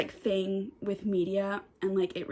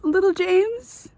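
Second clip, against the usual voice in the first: high, slow, tearful wail, much higher and louder; crying; 12 semitones higher, 8 dB louder, slower than usual. Real, not acted. Reader crying over the last pages, high and loud. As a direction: sob the words out.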